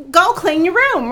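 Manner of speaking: mock sharp aunt; sharp order. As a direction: snap the order at a teenager. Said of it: "Ooh, voice inflection".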